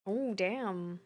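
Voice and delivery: normal talking voice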